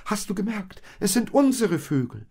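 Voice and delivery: high, loud eager call; excited calling. Acted. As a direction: call out to Josef in joy. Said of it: "rief Maria".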